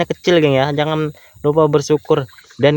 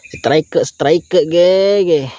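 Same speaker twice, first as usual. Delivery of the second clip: rising, louder shouts of strike; higher and louder at each strike; excited strike call; 4 semitones higher, 3 dB louder, much slower than usual. Real, not acted. Fish takes the bait.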